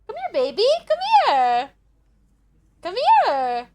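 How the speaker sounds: high-pitched pet call; calling a cat. Real, not acted. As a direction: coax a cat over in a high sing-out. Calling her cat to come.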